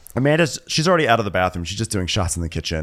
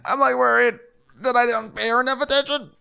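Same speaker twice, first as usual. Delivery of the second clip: put-on tearless drunk sob; 9 semitones higher than usual. Groom confessing to a friend, mock tearless drunk sobbing. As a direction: wail through it like a weepy drunk, badly faked. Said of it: "he's doing that tearless, tearless drunk sob".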